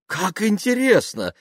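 high-pitched, drawn-out delight; delighted exclamation. Acted. Dasha thrilled to hear about the actor's role, delighted exclamation. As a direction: exclaim with eager wonder.

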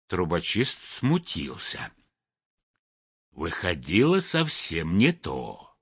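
usual speaking voice

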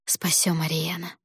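hushed, hollow whisper; lifeless whisper. A narrator's reading. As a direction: breathe it out, empty and spent. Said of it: "прошептала я безжизненным голосом".

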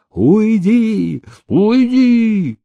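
high panicked cry; frightened plea. A narrator's reading. Paralytic waving off the peasant, panicked high cry. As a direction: beg him away in terror. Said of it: "в испуге замахал рукой".

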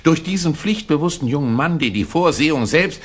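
an official praising the informer to His Excellency, gushing; gushing praise. Acted. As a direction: gush over the dutiful young man.

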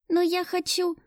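put-on high little-boy protest; young child. A narrator's reading. Boy protesting being sent to his room, child's voice. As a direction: object in a small child's voice.

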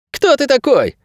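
put-on very high child's voice; agitated boy. A narrator's reading. Boy demanding to know who Найрод is, agitated. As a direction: question him in a piercingly high boy's voice. Frightened boy questions the man.

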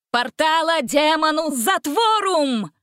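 high, drawn-out incantation; cried-out spell. A narrator's reading. Prince casts spell to close the portal, crying out. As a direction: call out the spell, stretching each word. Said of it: "выкрикнул он".

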